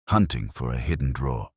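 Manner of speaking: plain reading voice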